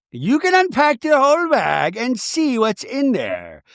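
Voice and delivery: high, hammed-up instruction; mock grand hostess. Castmate urging her to unpack the bag, theatrical.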